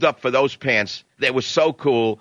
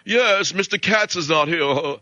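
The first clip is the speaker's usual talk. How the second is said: put-on slow, prim British receptionist accent; 4 semitones higher, slower than usual. Retold garment-center receptionist answering the phone in a British accent. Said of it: "a chick who had a British accent".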